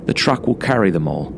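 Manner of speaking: usual speaking voice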